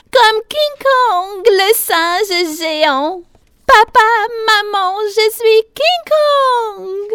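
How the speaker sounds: very high, loud, slow roar; triumphant child shouting. A narrator's reading. Piglet announces to papa and maman she is King Kong, high shouting. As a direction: bellow it proudly, stretching the words.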